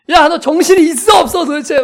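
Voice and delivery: loud, high scolding; angry shouting. Retold or imitated. Father berating child who interrupted his sermon, shouting. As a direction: re-enact a dad blowing his top at his child. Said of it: "소리를 질렀어요".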